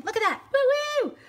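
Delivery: very high, loud whooping joy; thrilled cheer. Real, not acted. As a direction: whoop with delight. Thrilled the ink blends.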